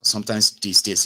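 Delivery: ordinary talk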